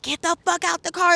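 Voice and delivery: high, loud barked order; screaming cop. Retold or imitated. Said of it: "like, screaming".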